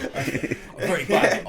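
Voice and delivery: loud, high, slowed by real laughter; laughing while talking. Real, not acted. Admitting a weakness to friends, laughing out loud. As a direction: crack up through the words. Cracking up at own admission.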